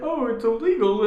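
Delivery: high mocking whine. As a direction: sneer it like a critic. Mocking critics.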